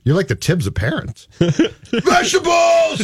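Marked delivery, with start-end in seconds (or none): 1.4-3.0 long drawn-out yell; screaming. Retold or imitated. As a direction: holler it high and stretched. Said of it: "Screaming it, totally".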